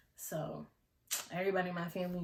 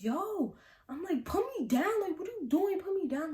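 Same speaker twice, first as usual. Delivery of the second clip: high alarmed put me down; protesting yell; 10 semitones higher, 3 dB louder than usual. Retold or imitated. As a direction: kid held in the air protesting. Lifted up, protesting.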